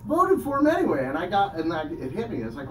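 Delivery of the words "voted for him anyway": much higher and louder, disbelieving; can't believe they did it; incredulous. Real, not acted. Incredulous punchline build.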